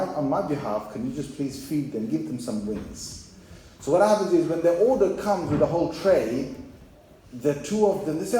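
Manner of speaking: usual speaking voice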